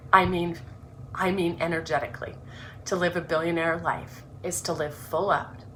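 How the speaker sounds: earnest, measured reading of her own introduction; reading her book aloud. Acted. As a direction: read it like a heartfelt opening chapter.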